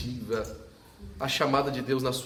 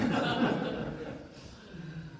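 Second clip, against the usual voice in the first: laugh; 6 semitones higher than usual. Real, not acted. Pastor laughing at his story.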